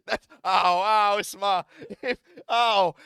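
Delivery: loud ow. Real, not acted. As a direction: cry out between laughs. Laughing in pain, crying out.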